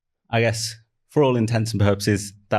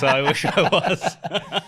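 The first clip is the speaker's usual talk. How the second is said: high, slow, wistful laugh; 9 semitones higher, much slower than usual. Real, not acted. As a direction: laugh it out, wishing it true. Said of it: "repeated wistfully with laugh".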